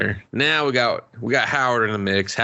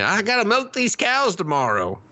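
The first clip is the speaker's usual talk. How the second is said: put-on old woman voice; 11 semitones higher than usual. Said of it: "she's just like".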